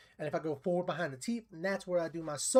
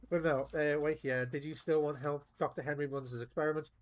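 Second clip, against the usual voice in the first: ordinary talk, nothing marked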